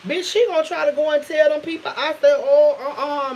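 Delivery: loud, high-pitched burst of alarm; alarmed realization. Retold or imitated. Teller realizing the cousin will spread gossip, alarmed.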